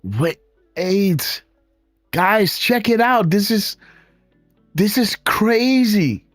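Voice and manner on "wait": normal talking voice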